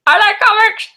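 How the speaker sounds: very high-pitched awkward blurt; awkward self-imitation. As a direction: squeak it out, mocking your own social panic. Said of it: "don't know how to handle those situations".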